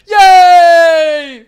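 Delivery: high, long shouted cheer; loud cheer. Pretend. Streamer celebrating a cosplay compliment, cheering very loudly. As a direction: belt out a drawn-out hooray.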